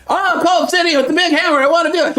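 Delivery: high-pitched gushing excitement; giddy fanboy over a new champion; excited gamer. Retold or imitated. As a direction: squeal it like a kid seeing a new toy. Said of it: "but I'm like".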